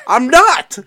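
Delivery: high, loud yelp of denial; indignant protest. Real, not acted. Co-host denying he is anti-Semitic, loud indignant protest. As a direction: burst out defensively. Protests co-host's doubt, mock defensive.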